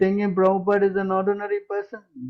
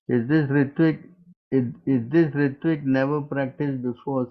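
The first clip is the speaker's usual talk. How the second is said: normal talking voice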